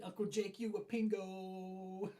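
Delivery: high and slow, drawn out; high sing-song. Pretend. Reading a viewer's comment aloud.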